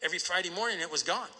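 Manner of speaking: usual speaking voice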